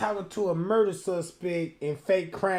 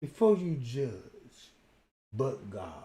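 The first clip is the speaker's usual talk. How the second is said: low, soft mock-soothing voice; calming elder; 6 semitones lower, 3 dB quieter than usual. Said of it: "that bitch come to you like".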